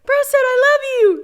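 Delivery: very high, loud excited gush; squealing high with joy to her husband. Retold or imitated. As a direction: burst out with the big news. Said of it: "I'm like".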